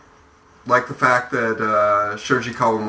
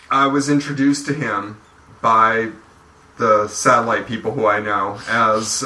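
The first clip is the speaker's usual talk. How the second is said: ordinary talk, nothing marked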